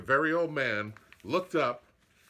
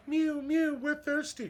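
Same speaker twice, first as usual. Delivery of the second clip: high mewing cat voices, pleading; cats crying. Acted. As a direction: mew like thirsty little cats. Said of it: "cried the hundreds of cats".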